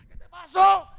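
loud, high demand to know; alarmed question. Retold or imitated. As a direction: call out in acted alarm. Retold alarmed question, louder.

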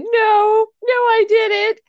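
imitating a crying little girl; crying denial. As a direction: protest through tears, like a scared kid. Crying child denies accident.

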